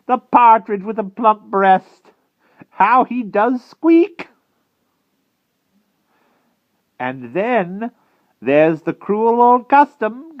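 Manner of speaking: high, loud, gloating witch; Madam Mim. A narrator's reading. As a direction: savour each word as a hungry witch.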